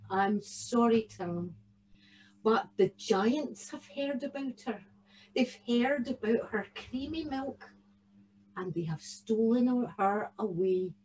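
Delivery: soft, subdued mother's apology; gentle bad news. A narrator's reading. Tam's mum breaks sad news to him, quietly. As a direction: break it softly.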